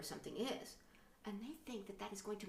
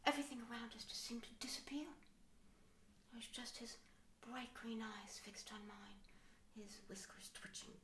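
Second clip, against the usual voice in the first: hushed, breathy storybook whisper; soft dreamy hush; mock dreamy whisper; 13 dB quieter than usual. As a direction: whisper it like a swooning romance. Mock romantic story opening.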